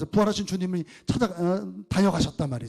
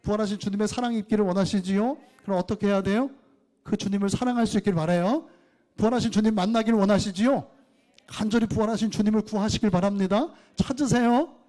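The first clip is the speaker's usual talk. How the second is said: raised, pressing sermon voice; fervent preaching; 5 semitones higher, 4 dB louder than usual. Acted. Pastor exhorting the congregation, fervent preaching. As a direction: urge the congregation with rising fervor.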